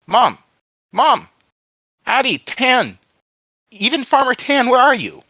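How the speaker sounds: high, full-force cries for help; desperate shout. A narrator's reading. Tied-up boy shouting for his mother, desperate. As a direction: shout for mom and anyone near. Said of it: "He began to shout".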